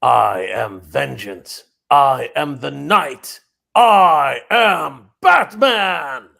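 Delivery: Batman voice. Pretend. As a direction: growl it slowly, building to a yell. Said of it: "who Batman is".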